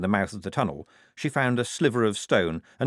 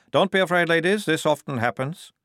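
a lightly higher, louder corporal voice; soothing the ladies with a touch of swagger; 7 semitones higher, 4 dB louder than usual. A narrator's reading. Corporal telling the women not to worry, lightly voiced.

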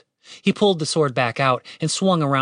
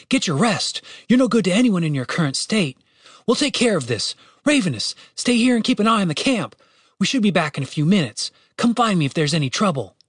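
higher-pitched character voice giving orders; urgent, raised-pitch instructions; Julian taking charge; 6 semitones higher than usual. A narrator's reading. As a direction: organise everyone before heading to a rescue.

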